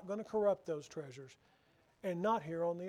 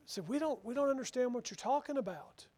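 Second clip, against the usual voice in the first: ordinary talk, nothing marked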